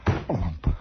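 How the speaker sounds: very low, popped "blump"; imitated tail thump. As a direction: thud it out deep and short. Imitating the tail's thumping sound.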